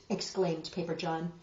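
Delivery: ordinary delivery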